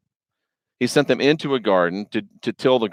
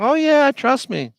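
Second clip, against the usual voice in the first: much higher, slower, coaxing con-man voice; oily tempter sealing the deal; sneaky snake; 12 semitones higher than usual. Retold or imitated. Sneaky snake coaxing Adam, Eve.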